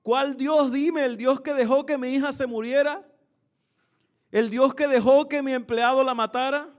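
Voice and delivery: retold grieving father's outburst; angry reproach. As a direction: throw the questions back at God. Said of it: "le reclamaba".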